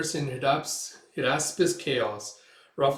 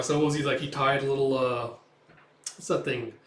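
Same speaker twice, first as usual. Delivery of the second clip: ordinary talk, nothing marked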